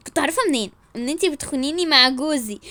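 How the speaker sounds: usual speaking voice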